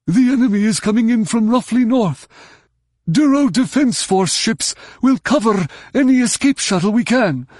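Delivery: high, loud alien radio call; urgent Duros voice. Acted. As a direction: warn everyone over the comm. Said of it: "a Duros voice".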